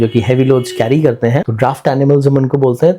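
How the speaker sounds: normal talking voice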